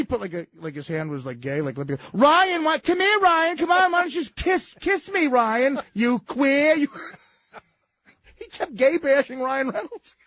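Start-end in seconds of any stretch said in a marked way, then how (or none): 2.1-6.9 high, loud, teasing sing-song; mocking taunt. Farley mocks the young actor between takes, high loud teasing. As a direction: taunt him in front of the crew.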